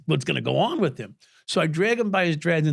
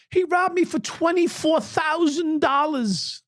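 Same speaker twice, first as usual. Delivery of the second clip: higher and slow, imitating her complaint; mimicking the woman; 13 semitones higher, slower than usual. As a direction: drag it out in her voice. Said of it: "she's telling this cop".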